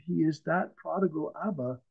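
usual speaking voice

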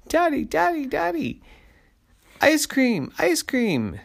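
put-on very high, loud little-kid clamour; high-pitched childish pleading; begging kids. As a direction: squeal for a treat like excited children. Kids beg for ice cream.

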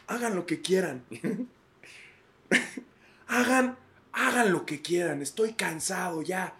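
high, slow, defeated father giving up; worn-out dad surrendering to his kids; exhausted dad. Acted. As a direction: sigh it out like a dad who has had enough. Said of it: "papá cansado".

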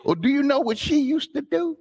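put-on gossiping old acquaintance. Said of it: "somebody who knew me".

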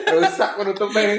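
laughing high and slow; words squeezed out through laughter. Real, not acted. Cracking up at a joke.

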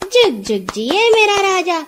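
high, joyful blessing. A narrator's reading. Nora blesses her husband, joyful. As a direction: bless him with delight. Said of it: "نورا تالیاں بجا کر بولی".

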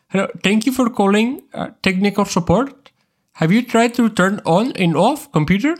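mock Indian accent for a help desk caller; bad Indian accent. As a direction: play an Indian tech support agent, badly on purpose. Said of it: "I can't really do a good Indian one".

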